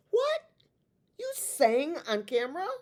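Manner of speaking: high, loud mock disbelief; incredulous friend. A friend hearing about singing on camera, in squealing mock disbelief. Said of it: "not a person who knows me".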